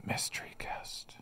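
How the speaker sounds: hushed mystery whisper. Acted. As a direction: breathe it like a secret. Whispered tease.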